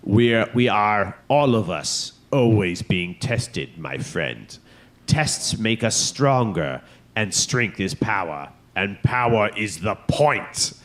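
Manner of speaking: slow, weighty, commanding emperor. Retold or imitated. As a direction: speak slowly, like a dark master. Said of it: "using the Emperor voice".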